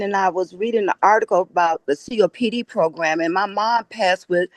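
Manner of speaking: ordinary delivery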